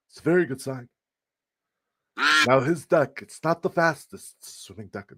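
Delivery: a rough, unconvincing presidential impersonation; Donald Trump. Acted. Streamer riffing on a winning duck, rough Trump impression. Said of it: "That's not a good Trump impression".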